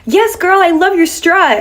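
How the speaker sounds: high-pitched mock cheer; imitated shout. Random girl cheering on a passer-by, imitated high-pitched shout. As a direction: call it out high, like an admiring stranger. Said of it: "some random girl shouted at me".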